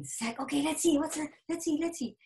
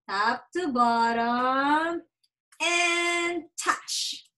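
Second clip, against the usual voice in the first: slow, drawn-out modeling voice; slow stretched demo; much slower than usual. Shown as a demonstration. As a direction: stretch each word slowly for a child. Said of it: "Okay, look".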